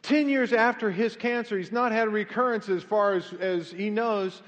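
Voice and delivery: full-force preaching, loud and high. Real, not acted. Pastor telling the congregation about a survivor, preaching. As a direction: preach it out to the crowd.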